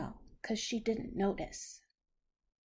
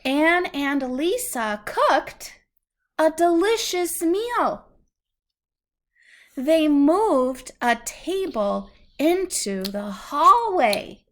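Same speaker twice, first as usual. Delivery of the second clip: lively, louder storybook reading; 3 semitones higher, 8 dB louder, slower than usual. Acted. Parent reads the happy turn of the story to a child, lively storybook reading. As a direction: build the moment for the child.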